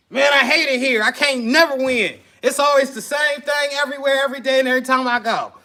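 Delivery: high, loud, bitter complaining; grumpy bad-day character. [name] acting his bad-day self to customers, loud and sour. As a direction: whine it out like the worst day ever. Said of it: "I'ma go into character real quick".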